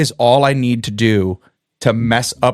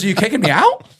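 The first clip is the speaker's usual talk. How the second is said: put-on higher, startled kid voice; worried student; 7 semitones higher, faster than usual. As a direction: ask it as if suddenly thrown out.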